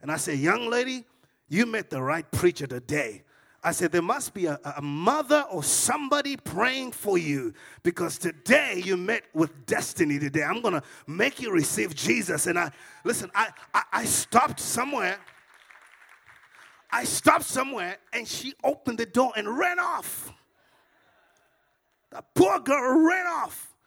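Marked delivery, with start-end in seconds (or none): 0.3-12.5 loud, forceful preaching at one person; upset preacher. Retold or imitated. Preacher confronts the young lady about destiny, forceful declaration. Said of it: "I'm getting really upset now". 13.3-23.7 loud, high-pitched climax of a story; full-force preaching. Acted. Preacher tells how the girl fled, full-force storytelling.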